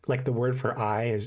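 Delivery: ordinary talk, nothing marked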